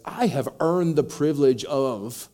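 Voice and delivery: loud, slow, entitled complaint; indignant elder son. Retold or imitated. Elder son insisting to his father, loud and entitled. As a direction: press every word like he's owed. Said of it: "which basically he says".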